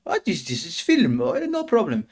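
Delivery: high, far-off mock mum voice, questioning; mum voiced high. Mum asking her son what he's watching, mock high voice. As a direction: imitate mum catching you watching. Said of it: "'Cause she".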